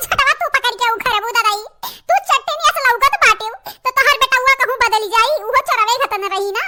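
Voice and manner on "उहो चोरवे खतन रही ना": ordinary delivery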